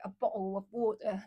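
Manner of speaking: glottal stops for the T in "bottle" and "water"; British-style "bottle of water" with the Ts swallowed. Shown as a demonstration. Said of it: "swallow all the tea sound".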